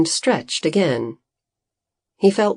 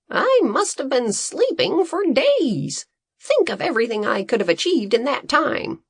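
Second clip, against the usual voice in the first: high, fast eager rant; excited scientist voice; 8 semitones higher than usual. Acted. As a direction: race through it, eager. Green character voice.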